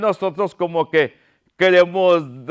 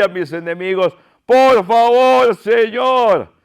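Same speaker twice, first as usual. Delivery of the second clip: pleading David; 4 semitones higher than usual. Acted. Preacher voicing David begging the Lord for help, pleading. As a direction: beg God with stretched words. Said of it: "David estaba postrado y llorando, clamándole".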